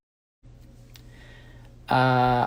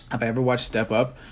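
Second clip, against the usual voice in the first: ordinary delivery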